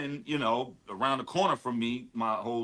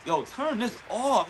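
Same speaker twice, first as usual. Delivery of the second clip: much higher, slow, overwhelmed disbelief; amazed plea; 10 semitones higher, slower than usual. Retold or imitated. Friend overwhelmed by the album, high slow disbelief. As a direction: beg him to stop it, it's too good. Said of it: "he was like".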